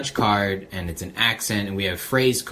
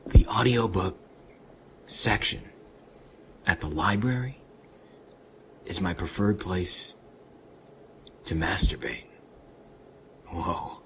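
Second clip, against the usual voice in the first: Keanu Reeves; 4 semitones lower, 4 dB quieter than usual. Acted. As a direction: draw it out softly with long pauses. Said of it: "All right, Keanu Reeves".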